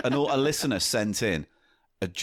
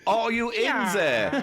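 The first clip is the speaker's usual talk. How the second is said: high, slow comic German; German accent; 8 semitones higher, slower than usual. Acted. German searcher in a joke script at the door, German accent. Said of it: "in a German accent".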